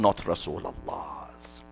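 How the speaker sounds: whispered aside, barely voiced; sarcastic whisper. Real, not acted. Preacher mocking the opposing claim, whispered aside.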